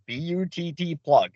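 "B U T T plug" read letter by letter; spelled out letter by letter. Shown as a demonstration. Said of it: "says on the package".

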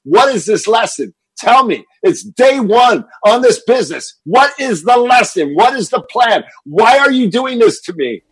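high, loud, desperate yelling; screaming at the sky. Retold or imitated. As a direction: rail at the heavens, demanding an answer. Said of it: "and I'm screaming".

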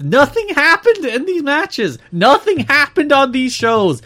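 high, loud exasperated complaint. Real, not acted. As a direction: throw up your hands, nothing happened. Exasperated rant, no notes.

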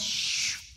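imitated email-sending whoosh; voiceless hushed swoosh of mail leaving; whoosh noise. Speaker showing the sound of sent email, breathy whoosh. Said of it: "you know that noise".

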